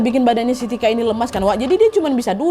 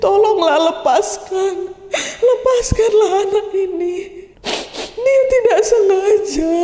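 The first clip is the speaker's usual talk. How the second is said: high, slow, acted weeping plea; thin, wailing voice begging for mercy; Nek Mary's tearful plea; 8 semitones higher, slower than usual. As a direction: plead through put-on sobs, drawing out each word. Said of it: "dengan suara lirih, Nek Mary bilang".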